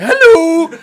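very high, very loud shriek in her face; scare shout. Retold or imitated. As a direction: yell it right into her face to make her jump. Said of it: "your face like".

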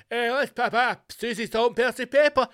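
high, loud TV-chef patter; sing-song mimicry of a celebrity cook; Ainsley Harry. Mocking a TV chef's back-in-the-day catchphrase, as a high, loud impression. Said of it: "Ainsley Harry going".

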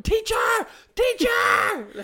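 put-on high excited child calling out; shouting. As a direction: call across the road, high and loud. Child shouting across the road.

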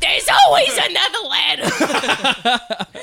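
slow, high, loud comic squawk; Gilbert Gottfried. Acted. Hammering home the ladder motto, Gilbert Gottfried impression. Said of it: "I sound like Gilbert Gottfried".

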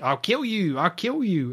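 imitating a shrill-pitched French shout, hammed up; high, silly mock threats in a French accent; high-pitched French henchman. Retelling Knick-Knack's threats to Bond, high French accent. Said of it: "high-pitched, uh, French accent".

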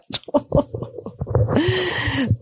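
high, soft breathy laugh; airy giggle tailing away; weary laugh. Real, not acted. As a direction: laugh helplessly at being unprepared. Laughs at being unprepared.

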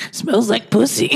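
goofy talking-dog voice. Acted. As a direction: voice it like a dopey dog. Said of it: "That's my dog voice".

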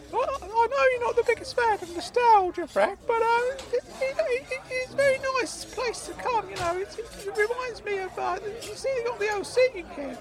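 high, halting squeaky voice; high-pitched fond character voice; nostalgic Claude. Acted. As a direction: ramble fondly in a high piping voice. Claude enthusing over nostalgia.

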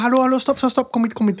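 high, eager call to stop and come. Retold or imitated. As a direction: call the two kids over from across the road. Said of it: "så roper jeg på dem".

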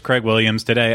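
ordinary talk

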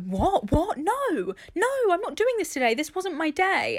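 high, protesting voice, mock alarmed; confused denial. Friend voicing her confusion at crying, high and protesting. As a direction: refuse the tears with mock alarm. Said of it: "you're like".

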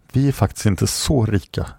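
plain reading voice

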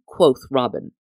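ordinary delivery

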